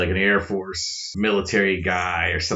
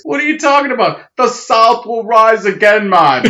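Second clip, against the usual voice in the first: put-on high, loud botched Southern accent turning Jamaican; indignant mock Jamaican-Southern shout; Southern turned Jamaican; 14 semitones higher, 10 dB louder than usual. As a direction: belt out a bad Southern accent that lands in Jamaican. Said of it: "your Southern accent because it turned out Jamaican".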